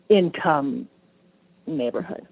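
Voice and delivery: ordinary talk, nothing marked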